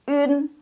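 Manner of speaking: un as "ün", held high; model ün. Shown as a demonstration. Teacher shows the reading with ü, high held ün. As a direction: keep lips pursed for ü in un, level. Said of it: "dari U dikasih buntutnya en".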